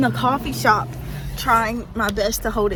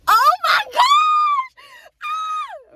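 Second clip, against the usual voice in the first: much higher, louder, drawn-out squeal; joyful shout; 8 dB louder, much slower than usual. Real, not acted. Speaker overwhelmed by her answered prayer, shouting joyfully. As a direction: scream with happy disbelief.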